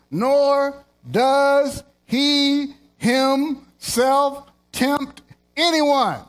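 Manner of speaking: building, slowing hard on the last words; slow, emphatic scripture reading. Acted. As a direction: drag out the final phrase for weight.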